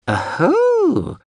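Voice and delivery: very high, drawn-out little cry; drone's knowing exclamation. Acted. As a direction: hoot it high in a tiny robot voice. Drone reacts to the blackout.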